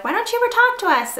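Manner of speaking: high-pitched mock whine of a viewer; complaining viewer. Voices complaining viewers in retelling.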